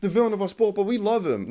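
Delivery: high mock fawning voice; mocking Drive to Survive. Mocking how DTS frames the Horner villain story, in a high sing-song. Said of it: "they tried to make Horner seem out like".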